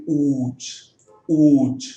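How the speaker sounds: ऊच with a long drawn oo; long-vowel example word; long oo demo. Shown as a demonstration. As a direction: hold the oo in ऊच. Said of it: "बड़ी ऊ की मात्रा".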